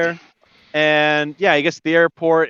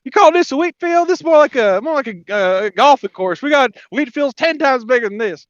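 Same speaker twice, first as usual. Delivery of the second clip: high-pitched, boastful disbelief; scoffing Texan; 7 semitones higher than usual. Retold or imitated. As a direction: belittle it as a bragging Texan tourist. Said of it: "and he's like".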